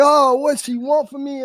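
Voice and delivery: mocking [name]. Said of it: "like [name]".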